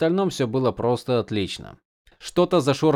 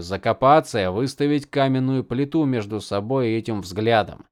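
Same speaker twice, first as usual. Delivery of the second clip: plain reading voice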